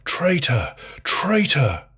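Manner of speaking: high, loud screams; loud, high-pitched cries of accusation; screamed accusation. A narrator's reading. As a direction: scream it out mid-chase at the scaffold. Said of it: "bleeding, screaming".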